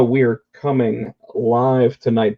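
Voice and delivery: normal talking voice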